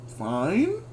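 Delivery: ordinary delivery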